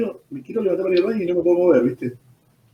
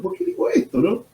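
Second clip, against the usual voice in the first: surprised self-question; 6 semitones higher, 3 dB quieter than usual. Retold or imitated. Startled at his own words.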